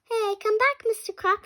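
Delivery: high, loud calling out; calling after. Acted. Kids calling after the runaway principal, loud and high. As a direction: shout after him to come back.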